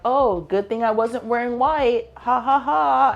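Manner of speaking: bright, high fake-cheerful quip with a put-on laugh; forced joke. To the man who spilled coffee on her, forced cheerful laugh. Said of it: "tried to make a joke of it".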